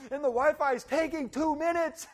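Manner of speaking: high, loud hammed-up rant at the Wi-Fi; throwing a shoe at the wall, mock outrage; mock frustrated yell. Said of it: "I'm throwing my shoe".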